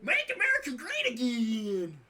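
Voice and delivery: loud, high, slow cartoon Uncle Sam; hammed-up Uncle Sam bellow. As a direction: boom it out like a goofy patriot.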